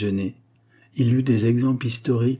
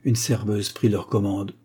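ordinary delivery, nothing marked

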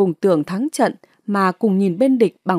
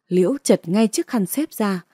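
ordinary delivery

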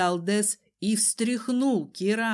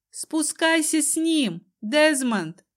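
raised, urgent command; loud shout; 7 semitones higher, 4 dB louder than usual. A narrator's reading. Heroine orders Desmond down with the cousin, shouting. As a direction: call out loudly, pitched up. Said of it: "громко крикнула я".

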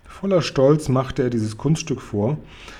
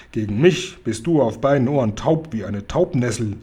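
usual speaking voice

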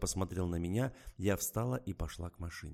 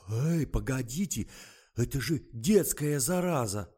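alarmed protest; 6 semitones higher, 9 dB louder than usual. Acted. Panicked publicist protests on phone.

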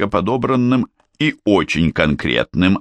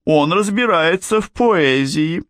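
high character voice; 11 semitones higher than usual. A narrator's reading. Mora vouches for him.

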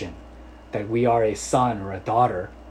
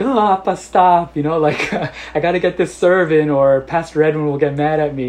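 embarrassed daughter; 5 semitones higher, 8 dB louder than usual. Retold or imitated. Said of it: "blushing and turning red".

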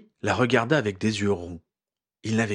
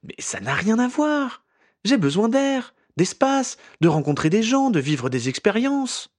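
higher, pleading Ginny with a slow start; impassioned young woman; 12 semitones higher, 3 dB louder than usual. A narrator's reading. Ginny pleads with Harry for air and space, much higher. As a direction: let it rise as she asks for space.

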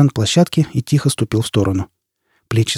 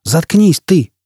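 higher-pitched angry interruption; angry order to be silent; furious outburst; 6 semitones higher, much slower than usual. A narrator's reading. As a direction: cut in with a flash of fury. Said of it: "Фойл яростно рванулся к ней".

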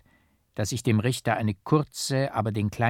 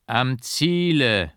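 very slow, louder, solemn call; solemn and strong; 4 semitones higher, 6 dB louder, much slower than usual. A narrator's reading. Painter calling back to the prisoner from the door, slow, loud and solemn. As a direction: proclaim it slowly so the vault rings. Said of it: "feierlich und stark".

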